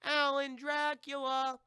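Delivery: drawn-out, high call across the woods. Retold or imitated. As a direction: stretch the name out like you're searching for him. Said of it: "calling out for Alan".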